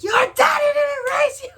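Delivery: very high, loud furious imitation; exploding angry grandma. Grandma lit up like the angry one, enraged shriek. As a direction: blow up in a hammed-up shriek. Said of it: "the angry one lights up".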